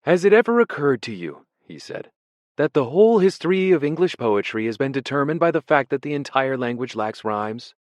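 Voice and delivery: high, loud, eager scholar's voice; pleased pedant. Acted. Ampleforth explains poetry to Winston, high and pleased. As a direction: share a useless fact with relish.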